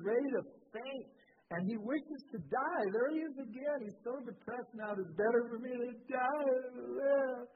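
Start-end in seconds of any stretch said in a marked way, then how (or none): 4.9-7.5 crybaby. Retold or imitated. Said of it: "He's crying now".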